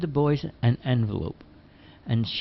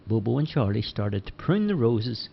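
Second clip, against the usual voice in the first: plain reading voice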